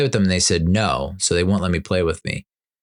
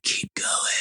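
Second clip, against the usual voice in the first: hushed unvoiced urging; whispered; 8 dB quieter than usual. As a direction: whisper it conspiratorially. Overflowing wine, still urging.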